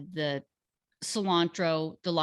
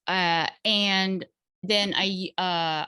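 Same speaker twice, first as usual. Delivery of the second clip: usual speaking voice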